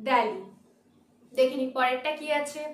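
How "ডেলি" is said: "দিল্লি" pronounced DEL-ee; correct English form. Shown as a demonstration. Teacher repeating what we should say, "দিল্লি" as DEL-ee. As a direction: say "দিল্লি" with e, lower and falling. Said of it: "আমরা বলবো".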